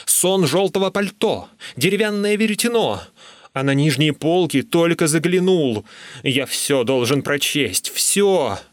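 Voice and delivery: high-pitched, insistent listing; worked-up, urgent protest. A narrator's reading. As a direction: plead your case, voice climbing. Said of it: "протестовал я".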